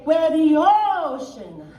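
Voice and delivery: loud, high, very slow chant; drawn-out choir demo. Shown as a demonstration. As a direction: stretch it out with big resonant tone. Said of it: "Okay, now say".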